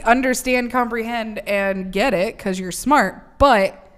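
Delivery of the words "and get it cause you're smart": normal talking voice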